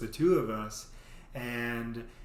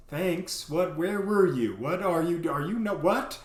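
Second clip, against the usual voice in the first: louder, higher exasperated scold; 4 semitones higher, 5 dB louder than usual. Retold or imitated. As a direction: tell him off. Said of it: "And she goes".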